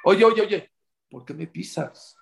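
acted indignation. Stepped-on person calls to one who walks off, angry. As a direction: call after the culprit, annoyed. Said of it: "te enojas".

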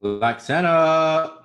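drawn-out hype call. Acted.

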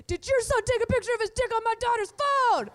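high, loud, fast accusing mom; mom confronting. Retold or imitated. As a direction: fire it off like an outraged mother on the phone. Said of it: "she opens with this cool line".

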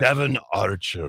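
a lightly plummy Eastern upper-class delivery; posh waspy name. Retold or imitated. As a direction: say it like old-money New England. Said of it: "very elevated name".

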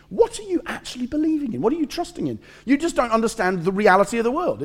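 very high, exasperated mocking challenge; incredulous accusation. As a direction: accuse them in a high, disbelieving voice. Voices enemy's accusation.

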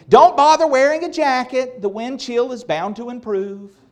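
a playful hint of a careless mother; mock mom voice. Preacher voicing an imagined mom to teens, light mock breeziness. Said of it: "Mom would never say".

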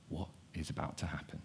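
nervous hush; hushed fearful thought. Acted. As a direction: ask it under your breath, scared. Scared disciples thinking in room.

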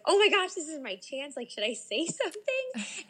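high, giddy re-enacted dithering; excited retold panic breaking into a laugh; starstruck panic. Retells starstruck panic over chance.

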